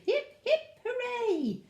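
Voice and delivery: high, loud hooray; high-pitched celebratory hooting cheer; shouted cheer. A narrator's reading. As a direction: cheer like a happy crowd. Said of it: "They shouted".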